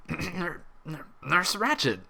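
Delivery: stammering, slow startled gasp of her name; choked out. A narrator's reading. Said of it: "the orderly finally choked out".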